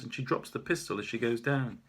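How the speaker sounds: ordinary delivery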